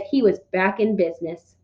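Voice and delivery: ordinary delivery, nothing marked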